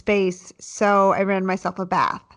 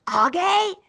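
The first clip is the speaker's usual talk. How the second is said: higher calling out for her son; 9 semitones higher, slower than usual. Retold or imitated. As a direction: call across the house, pitched up. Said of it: "So I start yelling".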